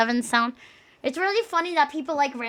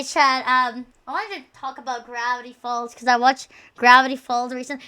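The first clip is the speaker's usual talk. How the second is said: ordinary talk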